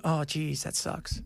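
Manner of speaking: very soft, fading to voiceless; mock muttered regret. Host imagines league bosses shrugging off lost viewers, softly muttered. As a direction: murmur it as token disappointment, fading away.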